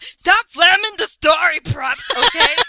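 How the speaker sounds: loud high shouted scold; hammed-up shouted plea; mock shout. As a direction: bellow the author's warning. Reading author's note, shouting.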